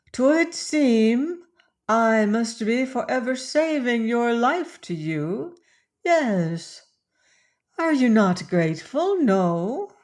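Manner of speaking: a light hint of a bold woman teasing; teasing Joanna. A narrator's reading. Joanna voiced in the story.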